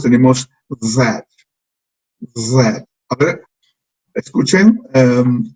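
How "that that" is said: that said clearly for the class; model that. Shown as a demonstration. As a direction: blend the sounds into that. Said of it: "juntamos los tres sonidos".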